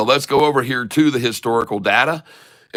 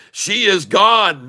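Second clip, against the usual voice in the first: usual speaking voice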